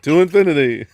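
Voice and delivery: booming mock-heroic catchphrase; Buzz Lightyear. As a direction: proclaim it like a cartoon hero. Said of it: "John should sound like Buzz Lightyear".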